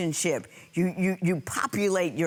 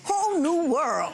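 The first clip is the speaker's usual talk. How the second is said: amused amazement; 9 semitones higher than usual. Real, not acted. Judge reacting to man's eyebrow waxing, amused amazement. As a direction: marvel at it, pitched way up.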